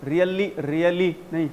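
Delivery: ordinary talk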